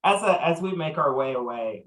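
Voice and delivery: ordinary talk, nothing marked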